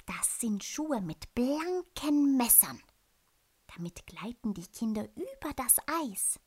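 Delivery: a knowing old owl, lightly voiced; wise owl voice. The owl explains to little bear.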